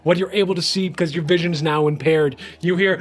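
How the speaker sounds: ordinary talk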